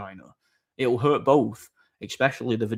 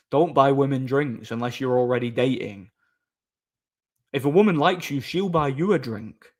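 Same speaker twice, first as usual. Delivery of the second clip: usual speaking voice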